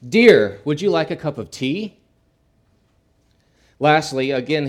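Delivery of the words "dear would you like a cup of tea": firm, loud punch on the first word; firm husband calling to his wife. Acted. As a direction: call it out firmly, hitting "Dear" hard. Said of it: "he said in a firm voice".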